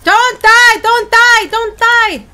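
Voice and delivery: loud pleading cry; urgent shout. Real, not acted. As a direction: yell it at a teammate. Urgent in-game plea.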